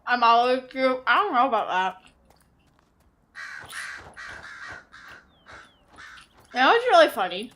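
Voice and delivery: talking through a mouthful of food; chewing steak. Real, not acted. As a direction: mouth full. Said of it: "Sorry, I'm talking with my mouth full".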